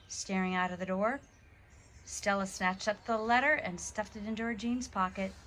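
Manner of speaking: ordinary delivery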